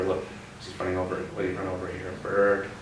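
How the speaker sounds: usual speaking voice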